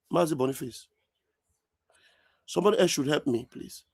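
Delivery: usual speaking voice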